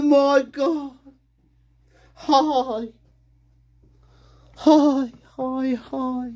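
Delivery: high, distressed little voice; high put-on character. Little character reporting trouble at home, high put-on voice. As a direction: squeak out the news, hesitant and repeating.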